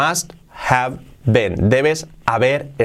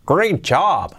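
admiring, higher-pitched mock praise; acted praise; 5 semitones higher, much slower than usual. Teacher acts out praising a learner, admiring and lifted. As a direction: play someone impressed by the work. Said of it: "Lo hacemos con atenuación".

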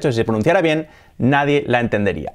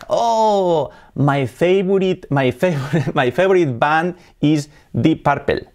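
mock fan with a heavy Spanish accent; Spanish accent, "Purple" as PAR-pel; Spanish-accented English; 4 semitones higher, slower than usual. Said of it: "No, my favorite band".

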